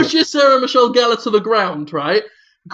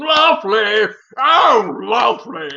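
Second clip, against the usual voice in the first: mock Patrick Stewart; slower than usual. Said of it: "Patrick Stewart going".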